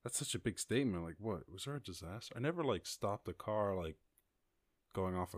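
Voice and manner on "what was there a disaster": ordinary talk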